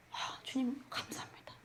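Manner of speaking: soft unvoiced whisper of thanks to God; whispering thanks to the Lord mid-testimony. Real, not acted. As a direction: breathe it out in a whisper.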